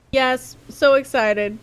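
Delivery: loud, slow excited cry. Shown as a demonstration. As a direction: draw out the excitement. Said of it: "That's how I feel sometimes".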